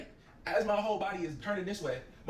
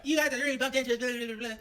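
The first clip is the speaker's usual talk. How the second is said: mock yelling girl; 8 semitones higher than usual. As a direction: imitate her yelling at them. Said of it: "they yell at us and say".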